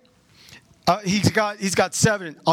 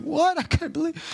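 high squeaky "What?"; mock disbelief; 12 semitones higher than usual. Congregation member shocked at pastor's news, squeal. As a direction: jump way up in pitch, shocked. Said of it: "you'd be like".

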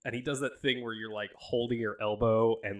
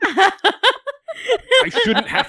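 much louder, slow exasperated scold; slow stern no, much louder; 7 semitones higher, 15 dB louder, much slower than usual. Acted. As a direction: scold them like naughty kids. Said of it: "and Zordon goes".

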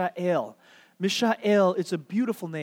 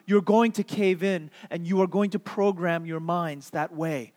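ordinary talk

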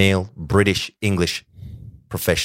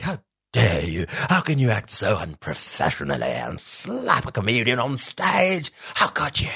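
theatrical, pearl-clutching indignation; 5 semitones higher than usual. Retold or imitated. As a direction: put on a scandalized voice, swooping high at the end.

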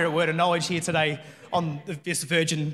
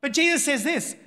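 usual speaking voice

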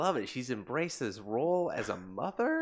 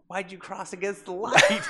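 laughing mock scold; 10 semitones higher, 8 dB louder than usual.

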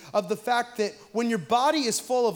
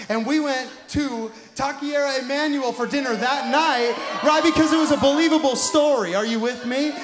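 loud, high rising preacher's shout; full-force preaching; 5 semitones higher, 7 dB louder than usual. Acted. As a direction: drive it home to the congregation.

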